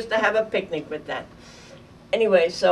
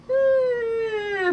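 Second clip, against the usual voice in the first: falling bomb whistle. Retold or imitated. Bombs falling right after.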